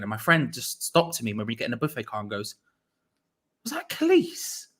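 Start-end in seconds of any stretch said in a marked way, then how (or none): 3.7-4.7 high, drawn-out astonished whisper-to-a-mate question; retold amazed question. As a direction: imitate a mate's incredulous double-take.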